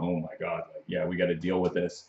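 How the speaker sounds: hushed, awestruck and barely voiced; softly stunned, a little shaken. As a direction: say it like the room just went dead quiet. Speechless people at the undead.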